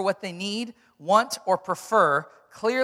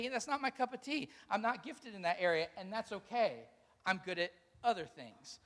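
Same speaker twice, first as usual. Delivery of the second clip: calm acceptance; 10 dB quieter than usual. Retold or imitated. Mature person voiced calmly.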